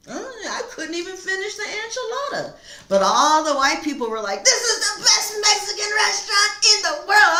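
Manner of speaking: high, laughing mock of the diners; laughing retelling. Reader mocking restaurant diners while laughing. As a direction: laugh through the quote.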